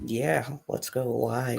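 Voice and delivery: soft, drawn-out near whisper. Real, not acted. Co-host agreeing to go live, whispering. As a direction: say it hushed and slow. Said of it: "you're whispering".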